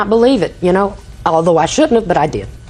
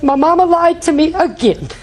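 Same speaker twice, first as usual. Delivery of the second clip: put-on upset child; 8 semitones higher than usual. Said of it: "She was so upset".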